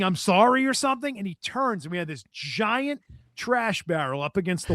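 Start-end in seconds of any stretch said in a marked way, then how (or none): none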